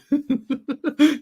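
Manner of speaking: shrill giggle burst; giggling in delight; high squeal of laughter. Real, not acted. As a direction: let a high laugh break out. Laughing at bigot joke.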